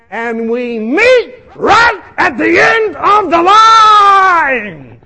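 high, slow, wailing shout; anguished holler. Retold or imitated. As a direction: stretch out the disaster at full volume. Climax of comic disaster.

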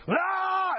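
high, drawn-out scream; high wail like someone possessed; demoniac yell. Retold or imitated. Patient going into demoniac mode, high yell. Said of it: "the guy starts yelling again".

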